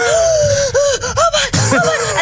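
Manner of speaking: high, loud British squeal; British scream. Retold or imitated. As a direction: reenact a frightened British shriek on the run. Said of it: "[name], you sound really British".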